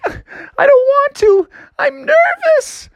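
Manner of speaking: very high, drawn-out mock whimper; whining with nerves. A narrator's reading. Froggy refusing the sheriff, high slow nervous whine. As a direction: squeal it like a scared little cartoon frog. Frightened Froggy balks at riding.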